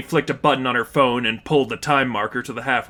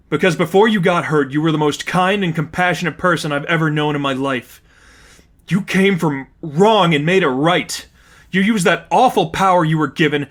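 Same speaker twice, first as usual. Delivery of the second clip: pleading; 4 semitones higher, 5 dB louder than usual. A narrator's reading. Pleading with angry friend.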